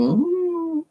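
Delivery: high, wobbly dazed ooh; a woozy moan from a head full of smoke; stoned ooh. Retold or imitated. On the drive home still high from the smoke, a high woozy ooh.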